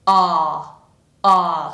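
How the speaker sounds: loud, low-pitched "Ah", lips loosely open. Shown as a demonstration. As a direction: sustain "Ah" with a dropped jaw. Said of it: "It's less round than that".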